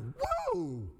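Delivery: high, drawn-out "whoa"; amazed whoop. Retold or imitated. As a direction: whoop in mock astonishment. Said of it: "It's like".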